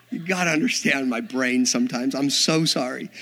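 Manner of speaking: ordinary delivery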